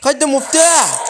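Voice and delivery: high, loud and drawn-out cry; urgent excited shout. Real, not acted. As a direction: call it out at full pitch, urgently. Grabs the key under pressure.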